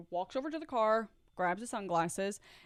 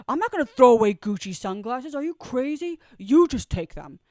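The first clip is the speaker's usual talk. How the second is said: put-on loud, indignant boyfriend voice; imitating boyfriend's loud scoff; mock outraged retort; 4 semitones higher, 12 dB louder than usual. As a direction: blast it back like he can't believe it. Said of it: "And Pan goes".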